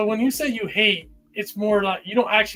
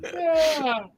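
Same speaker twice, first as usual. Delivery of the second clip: high, loud outcry; shouting; 9 semitones higher, 6 dB louder, much slower than usual. Real, not acted. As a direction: yell it out, mock horrified. Reacting to ASMR Toadette edition.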